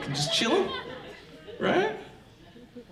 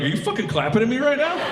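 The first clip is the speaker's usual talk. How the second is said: higher-pitched incredulous telling-off; mock indignation; 8 semitones higher, faster than usual. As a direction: act affronted, pitch jumping up. Imagined person snapping at clappers.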